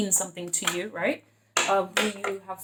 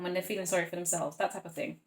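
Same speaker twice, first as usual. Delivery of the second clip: ordinary talk, nothing marked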